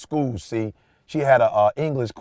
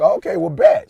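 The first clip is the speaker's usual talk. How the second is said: much higher, hyped okay well bet; excited approval; 6 dB louder than usual. Retold or imitated. Proud dad reacting to his daughter, excited. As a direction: pop way up, cheering her on.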